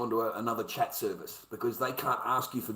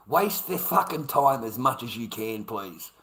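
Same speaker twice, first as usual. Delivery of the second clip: ordinary talk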